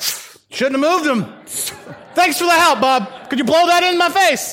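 loud, high sarcastic jab; mocking bystanders. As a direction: sneer at the helper. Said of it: "looking at him like".

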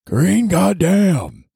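green goddamn stretched very slow, high and loud; drawn-out emphasis. Acted. Mocking listeners' indifference, very slow high green goddamn.

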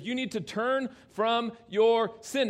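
ordinary delivery

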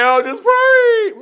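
mock the faint-hearted soldier, overdo it; hammed-up whiny prayer. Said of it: "Notice he didn't say".

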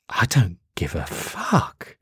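slow, breathy whispered dismissal; the devil whispers his contempt to Demon Six. Acted. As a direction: hiss it out under your breath, slowly. Devil dismisses the bad news.

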